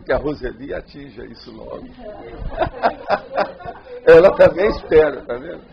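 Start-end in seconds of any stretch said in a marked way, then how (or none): 1.9-4.0 high, breathy burst of laughter; long amused laugh; hearty laugh. Real, not acted. Laughs after teasing hope.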